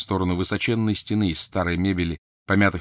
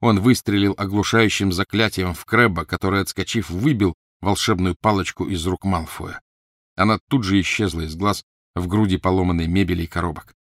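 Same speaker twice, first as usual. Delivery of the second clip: usual speaking voice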